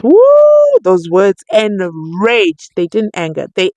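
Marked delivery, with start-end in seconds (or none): 0.0-0.8 very high, loud whoop; excited whoop. Real, not acted. Vlogger whooping as the judge is provoked, excited. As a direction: whoop at the drama. Reacting to lawyer's words.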